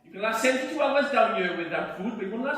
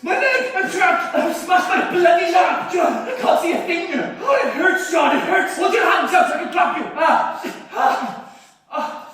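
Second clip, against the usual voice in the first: panicked shouts, much higher and louder; trapped and in pain; 8 semitones higher, 9 dB louder, slower than usual. Acted. Trapped miner crying out to his friend in pain, panicked. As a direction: scream for help through the pain.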